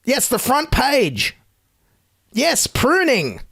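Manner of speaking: slow and high, dripping sarcasm; dry sarcasm. Acted. Host mocking the news story, slow sarcastic drawl. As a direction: drag it out as if truly impressed.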